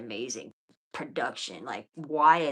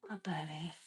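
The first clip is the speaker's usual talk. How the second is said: whisper; 11 dB quieter than usual. Real, not acted. Whispering lovingly to her baby.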